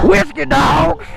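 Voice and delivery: shouting. Real, not acted. Excited shouting while riding.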